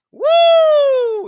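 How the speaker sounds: very high, loud, long woo; excited whoop. Real, not acted. Commentator celebrating a knockout, excited whoop.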